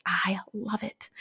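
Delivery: breathy whispered aside, I love it said slow and hushed; delighted whisper. Real, not acted. Host whispering her delight to listeners, slow hushed whisper. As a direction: lean in and whisper it like a happy secret.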